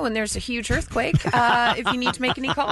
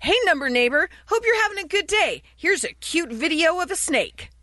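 higher, upbeat, quick hello; cheery greeting; 6 semitones higher than usual. Acted. Host reads a text offering a snake video, cheery. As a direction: act out an eager friendly message.

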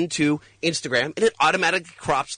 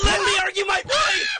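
frustrated shout. Real, not acted. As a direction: yell to get a word in. Heated debate, cut off.

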